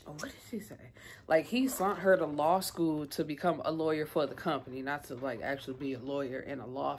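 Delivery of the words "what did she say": whispered aside to yourself; hushed, absent-minded self-question; thinking aloud. Real, not acted. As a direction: whisper it while searching your memory. Asking herself while trying to remember.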